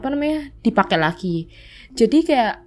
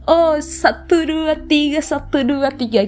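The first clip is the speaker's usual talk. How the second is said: peppy counting; 5 semitones higher than usual. Retold or imitated. Narrator recalls leading school gymnastics, counting with high eager pep. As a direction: count out the exercise like an eager kid leader. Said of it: "senam tuh kayak bersemangat banget".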